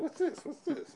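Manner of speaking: high squeaky puzzled voice; mock high questioning. Patient mimicking staff, high and puzzled. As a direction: imitate suspicious staff asking.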